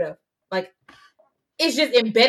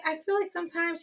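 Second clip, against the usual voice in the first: much higher, softer put-on mom voice; mom's voice; 8 semitones higher, 8 dB quieter than usual. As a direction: play the mom, prim and sweet. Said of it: "She doesn't talk like that".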